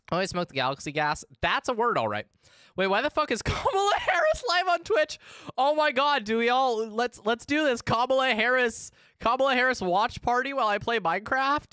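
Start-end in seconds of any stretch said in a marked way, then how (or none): 2.8-11.7 real high-pitched, incredulous outburst; excited disbelief. Real, not acted. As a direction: squeal it out, amazed and thrilled. Stunned by a live stream find.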